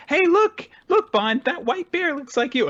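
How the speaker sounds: put-on high-pitched excited child voice; excited little girl. A child pointing out a bear to her dog, excited. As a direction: squeal it like a small kid.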